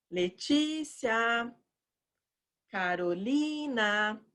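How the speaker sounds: slow, sing-song name call; stretched vowels, as if summoning someone. Shown as a demonstration. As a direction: hold the TI of Letícia and LI of Carolina long. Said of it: "igual você chama a pessoa".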